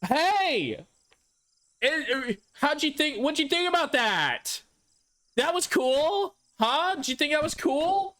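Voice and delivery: hammed-up high voice asking if he liked it; chatty woman in the theater. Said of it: "And she'd be like".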